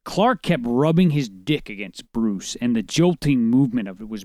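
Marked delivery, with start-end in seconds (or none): none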